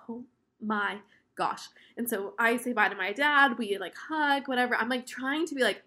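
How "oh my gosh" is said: breathy unvoiced whisper, slowed down; hushed nervous whisper; whispered to herself. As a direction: mutter it under your breath in a panic. Nervous bride in the rain.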